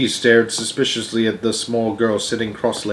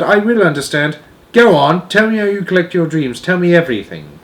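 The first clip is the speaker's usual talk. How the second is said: put-on higher, louder insistent girl; eager Sophie; 8 semitones higher, 6 dB louder than usual. Sophie urges the giant to explain dreams, eager. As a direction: urge it on like an eager child.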